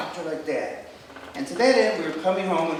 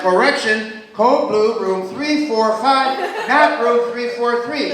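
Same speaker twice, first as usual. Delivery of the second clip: loud, slow hospital PA announcement; loudspeaker announcement; 5 semitones higher, 11 dB louder, slower than usual. Retold or imitated. Intern hears the loudspeaker correct the room, loud announcement.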